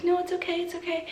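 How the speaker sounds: high, soft soothing voice; hushed, high-pitched comforting; soft reassurance. Retold or imitated. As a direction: imitate a doctor gently consoling a crying patient. Doctor consoling a crying patient.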